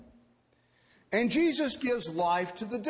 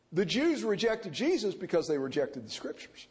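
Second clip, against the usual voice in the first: normal talking voice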